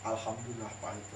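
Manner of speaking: imitating a sulker, low and subdued; sullen minimal reply. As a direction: drop low, give only the bare answer. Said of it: "Cuma bilang begitu". (menirukan jawaban cemberut, bernada rendah)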